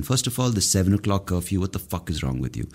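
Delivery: normal talking voice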